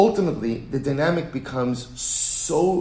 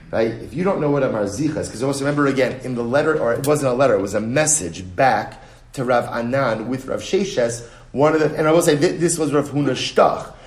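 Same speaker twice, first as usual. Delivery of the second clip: normal talking voice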